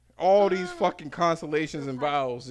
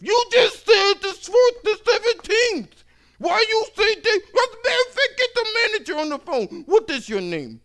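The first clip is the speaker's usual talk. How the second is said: enraged customer; 12 dB louder than usual. Retold or imitated. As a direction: shriek for the manager. Customer explodes.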